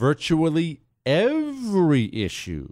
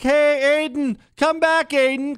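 put-on mom calling her kid; 12 semitones higher than usual. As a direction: yell after a runaway toddler. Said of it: "what she'd be yelling".

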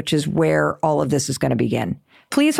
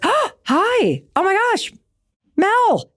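much higher, thrilled hello; delighted greeting; 13 semitones higher, slower than usual. Retold or imitated. Doctor thrilled to recognise the host, much higher voice. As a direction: greet her like an old friend.

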